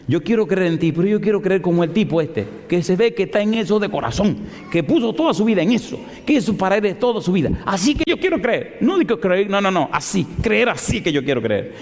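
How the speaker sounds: high, insistent imitation of her; impassioned plea. Atheist telling God how she wants to believe, impassioned. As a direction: plead with mounting insistence, pitched high.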